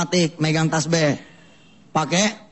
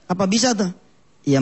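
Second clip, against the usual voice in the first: usual speaking voice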